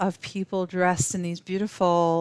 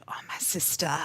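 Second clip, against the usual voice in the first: put-on whispered in a light African accent; hushed imitation of an African accent; 5 dB quieter than usual. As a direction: breathe it softly with an African lilt. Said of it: "she spoke with this beautiful African accent".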